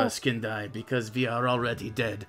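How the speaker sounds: a lightly put-on, terrible French accent, edgy philosopher; hammed-up French accent, smoking a cigarette; bad French accent. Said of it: "I can't talk in a French accent, so it's just gonna be terrible".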